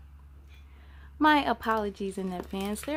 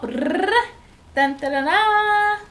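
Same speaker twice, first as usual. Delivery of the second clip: drumroll; 9 semitones higher, 9 dB louder, slower than usual. Acted. Announcing tonight's book title.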